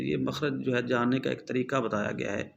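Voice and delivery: ordinary delivery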